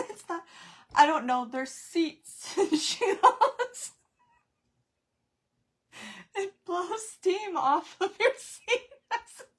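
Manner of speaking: very high, loud, words swallowed by laughter; breathless laughing. Real, not acted. Mother telling about the steam seat in her daughter's sauna, breathless laughing. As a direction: laugh until you can hardly breathe. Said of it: "I can't breathe at this".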